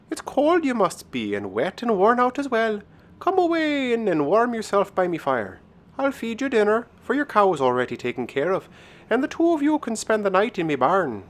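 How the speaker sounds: high-pitched, hospitable Irish lilt; Irish cottage hostess; welcoming Irish countrywoman. Acted. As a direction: usher a weary stranger in, voice pitched high. Voices the woman inviting him inside.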